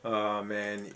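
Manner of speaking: ordinary talk, nothing marked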